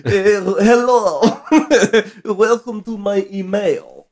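put-on hammy Russian accent, slow; mock Russian accent, drawn out. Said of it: "like, a Russian accent".

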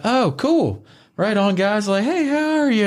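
high, overly chipper hello; trying too hard to be friendly; mock eager high voice; nervous greeting. Greeting intimidating bodyguards nervously.

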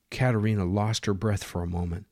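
usual speaking voice

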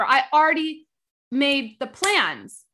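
fed-up emphasis; exasperated rant. Real, not acted. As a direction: stretch out made the plans in disbelief. Ranting about the man.

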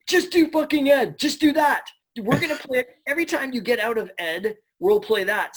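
high, loud excited command; the director insisting with a yell; loud insistence. Retold or imitated. Director yelling at the actor, high and loud. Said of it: "he's just like".

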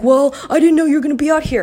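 high, loud mock excuse; mocking imitation. Mimicking the neighbor in the retold row, mocking.

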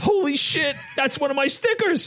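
high, thrilled disbelief; excited. Retold or imitated. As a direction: blurt it out amazed. Wigged out at seeing his sticker.

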